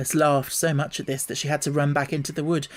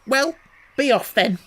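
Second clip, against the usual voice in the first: high, loud huffy dismissal; sulky; 9 semitones higher, 6 dB louder than usual. A narrator's reading. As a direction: shoo her off in a sulk. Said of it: "in a sulky tone".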